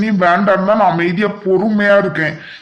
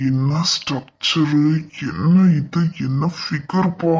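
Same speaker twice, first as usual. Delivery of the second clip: slow, softer leering murmur under his breath; leering aside; 4 semitones lower, 5 dB quieter, slower than usual. Acted. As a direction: savour each word while eyeing her. Ogling the girl aside.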